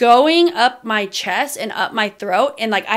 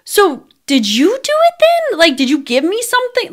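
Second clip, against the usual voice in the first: 1 incredulous question; 10 semitones higher than usual. Retold or imitated. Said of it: "I was like".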